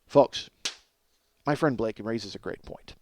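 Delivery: ordinary talk, nothing marked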